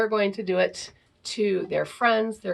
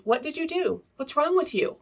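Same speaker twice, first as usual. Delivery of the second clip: exasperated questioning, pitched up; accusing questions; 6 semitones higher than usual. Shown as a demonstration. Parent interrogating a child, exasperated. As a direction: demand answers from a misbehaving child. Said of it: "An indirect".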